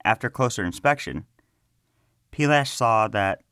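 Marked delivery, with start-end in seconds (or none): none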